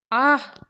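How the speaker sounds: short high groan of slight pain; a small wince of pain; mild pained groan. A narrator's reading. Husband bitten on the hand by his wife, mild pained groan. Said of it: "ہلکی سی تکلیف کے ساتھ کراہ بھری".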